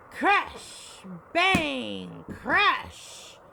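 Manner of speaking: loud, high drawn-out bursts; thunder crashes. A narrator's reading. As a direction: boom each word like thunder.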